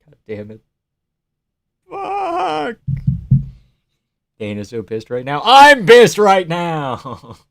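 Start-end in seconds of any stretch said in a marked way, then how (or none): 1.9-2.8 high pained cry of fuck; real frustration breaking out; anguished cry. Real, not acted. Pain after the loss. 5.4-7.4 loud, slow, each word leaned on; seething at the result; slow angry emphasis. Real, not acted. Angry at the loss.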